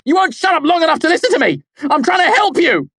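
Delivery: fast, high, very loud shout. A narrator's reading. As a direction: yell in frustration. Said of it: "I shouted".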